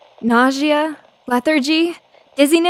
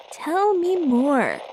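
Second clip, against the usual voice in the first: slow, drawn-out, savoring Tell me more; slower than usual. Acted. Reporter savoring a scoop from the caller, slow and drawn out.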